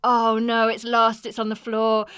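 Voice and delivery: acting out a moment of panic; retold panic. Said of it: "I'm just like".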